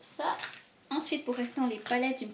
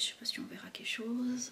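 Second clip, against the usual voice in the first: whispered aside; 4 semitones higher, 4 dB quieter than usual. Real, not acted. Trying a swatch on camera.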